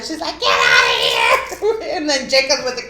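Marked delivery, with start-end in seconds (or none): 0.4-1.4 imitating mom yelling. Surprised mom in bathroom, imitated yell at intruders. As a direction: chase them out, hammed up.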